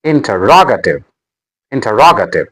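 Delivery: "Interrogative" with ROG made prominent; stress on third syllable. Shown as a demonstration. Teacher modelling word stress, "Interrogative" stressed inter-ROG-ative. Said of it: "The stress is on R".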